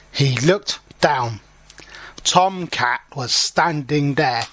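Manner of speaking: usual speaking voice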